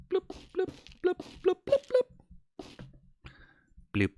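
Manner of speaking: squeaky bloop noises rising; cartoon bubble squeaks; very high squeaky bloops.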